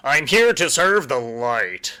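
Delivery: slow, loud hammed-up paladin vow; mock a pompous holy knight; grand mock declaration; overdramatic hero. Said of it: "it's so overdramatic".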